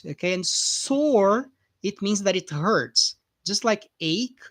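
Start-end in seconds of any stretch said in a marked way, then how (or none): none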